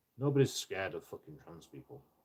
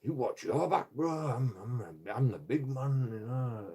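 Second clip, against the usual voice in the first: higher-pitched mock-indignant bluster; cocky comeback; 3 semitones higher than usual. Man retorting to a warning to watch his back, cocky. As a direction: puff yourself up like the big man.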